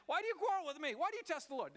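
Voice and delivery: high, loud rebuke of the grumbling crowd; exasperated Moses. Acted. Moses rebuking the quarrelling people.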